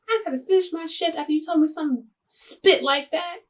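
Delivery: loud, high indignant outburst; outraged disbelief. Real, not acted. Venting to viewers about her boss, loud outraged disbelief. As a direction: blurt it out, stunned and offended. Boss denies going home early.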